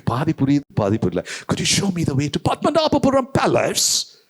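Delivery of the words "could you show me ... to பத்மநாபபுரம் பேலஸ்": high, slow mock foreigner asking directions. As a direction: play a foreign tourist stretching out the place name. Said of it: "அவன் உன்னை பார்த்து கேட்கான்".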